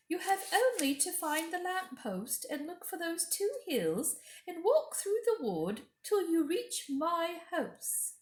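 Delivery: high, sweet coaxing queen; high, coaxing witch-queen voice; queen's voice. Acted. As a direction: lure the boy in with a high honeyed voice. Queen speaks to Edmund.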